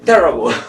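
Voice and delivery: loud, short English "terrible"; English pronunciation demo. Shown as a demonstration. Teacher contrasts it with the Spanish word, English "terrible" stressed on TER. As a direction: punch TER in "terrible" and drop the rest. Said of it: "La pronunciación".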